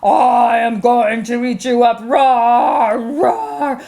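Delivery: deep, roaring troll. Acted. Troll climbs up to eat the goat, deep roaring threat. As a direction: menace them in a low monster voice. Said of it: "the big troll came up and said".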